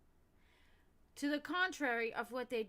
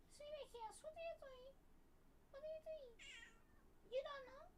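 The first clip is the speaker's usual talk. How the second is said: much higher, soft cooing pet voice; 14 dB quieter than usual. Real, not acted. Owner cooing at her crying cat, baby talk.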